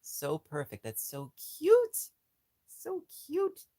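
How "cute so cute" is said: high, slow squeaky coo, louder; cutesy squeal. Real, not acted. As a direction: gush over it in a tiny high voice. Cooing over crocheted animal.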